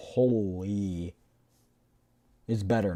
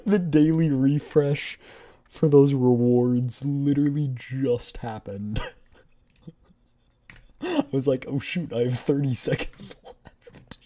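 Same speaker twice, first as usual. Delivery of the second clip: relieved laughing; 8 semitones higher, 8 dB louder than usual. Real, not acted. Relief after close call.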